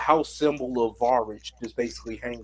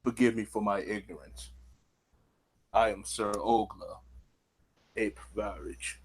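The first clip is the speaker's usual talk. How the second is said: soft, lowered troll voice, formal and humble; courteous troll; 3 semitones lower, 6 dB quieter than usual. Acted. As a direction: introduce yourself humbly, voice dropping low and quiet. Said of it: "he's a huge troll with a war hammer".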